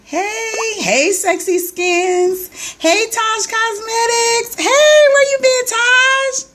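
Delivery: high, drawn-out singsong hellos; singsong greeting. Real, not acted. Host greeting viewers joining her live stream, high singsong. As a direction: croon each name warmly to new viewers.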